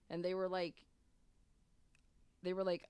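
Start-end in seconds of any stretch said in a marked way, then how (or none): none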